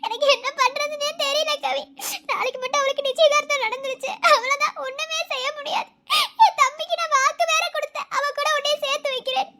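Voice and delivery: high, tearful and helpless; tearful lament. Acted. Sister pours out her grief to her friend, tearful and high. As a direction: weep out the sister's guilt.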